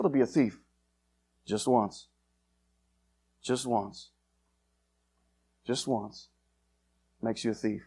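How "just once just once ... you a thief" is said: grave hush. Acted. Preacher drives home a point.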